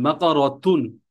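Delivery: slow "বাকরতুন" with a clear -tun ending; tanween ending. Shown as a demonstration. Teacher pointing out tanween to students, tanween ending. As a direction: sound out "বাকরতুন" slowly through the final "tun". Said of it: "তানবিন পড়ছি".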